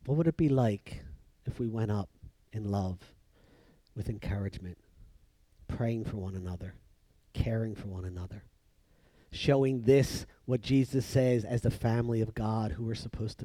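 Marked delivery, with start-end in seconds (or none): 0.0-8.4 hushed, low, with gentle pauses; tender appeal. Real, not acted. Preacher urging the church to love, hushed appeal. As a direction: lay out each phrase softly and sincerely.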